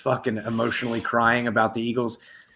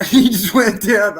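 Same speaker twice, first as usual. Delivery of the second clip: slow, loud laughing delivery, pitched higher; higher, louder words said slowly while laughing; 8 semitones higher, 11 dB louder, slower than usual. Real, not acted. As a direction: laugh through it as he drops. Laughing after kick.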